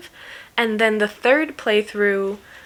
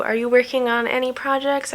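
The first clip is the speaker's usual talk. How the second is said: normal talking voice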